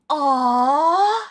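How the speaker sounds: long, rising mock coo; sitcom "aww". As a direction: imitate a sappy studio audience. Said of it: "the sitcom moment of".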